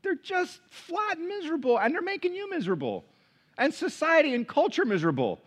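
exasperated. Acted. Exasperated about miserable families.

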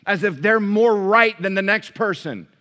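loud, high preaching push; full-force preaching. Acted. Pastor preaching hard to the congregation, loud. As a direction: drive it home from the pulpit.

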